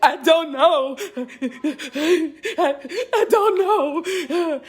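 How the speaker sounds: high, slow, laughing through tears; retelling her own tearful "I don't know" to her viewers; sobbing laugh. Retold or imitated. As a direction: choke it out between sobs and laughs. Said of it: "in between my sobbing, I was like".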